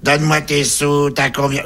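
put-on madam greeting a young client; brothel madam. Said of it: "une grosse mère macrée".

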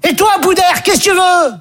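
high, acted confrontation; annoyed challenge. Stéphanie rounds on Boudère next, high-pitched and annoyed.

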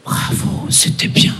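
whispered, grudging praise; whispered compliment. Retold or imitated. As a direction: breathe it out coldly. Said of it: "elle me dit". (la dame chuchote un compliment pincé)

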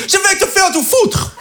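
high, garbled mock insult; mock road rage. Cut off in traffic, high mock angry yell. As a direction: yell a mangled insult at a driver. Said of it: "je dis".

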